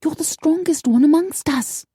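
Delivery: high, pleading female voice; worried coworker, voiced high; lightly voiced young woman. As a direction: beg him to stay. Emma voiced higher.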